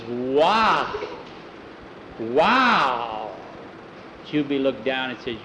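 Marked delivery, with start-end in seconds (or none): 0.0-3.3 long, drawn-out wow at usual pitch; awed wow. A narrator's reading. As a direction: breathe out slow admiration. Jackie admires the new collar.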